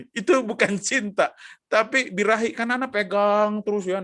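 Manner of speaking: usual speaking voice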